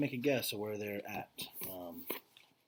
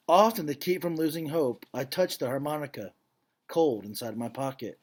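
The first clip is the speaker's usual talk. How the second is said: solemn, louder read-aloud voice; story narration; 5 semitones higher, 12 dB louder than usual. A narrator's reading. Teacher reading a Holocaust story to the class, grave narration. Reads picture book to class.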